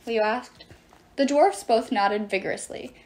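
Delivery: ordinary delivery, nothing marked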